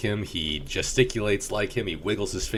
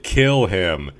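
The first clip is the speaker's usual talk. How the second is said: barked order; 8 dB louder than usual. Retold or imitated.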